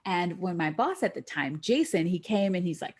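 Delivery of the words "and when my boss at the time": normal talking voice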